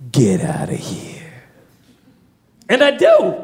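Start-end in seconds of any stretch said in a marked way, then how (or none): 0.1-1.3 very low, slow whisper; guard's low dismissal. Retold or imitated. Guard puts away knife, dismisses man, low slow whisper. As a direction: imitate a guard's menacing whispered order. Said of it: "And he goes".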